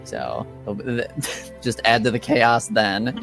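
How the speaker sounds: ordinary delivery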